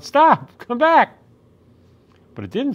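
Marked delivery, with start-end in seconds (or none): 0.0-1.2 high, loud shout after the fleeing hedgehog; child yelling at the hedgehog to stop. A narrator's reading. Child yells after a runaway hedgehog, loud and high. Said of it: "so I yelled".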